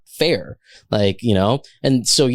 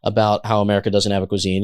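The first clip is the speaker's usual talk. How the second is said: ordinary talk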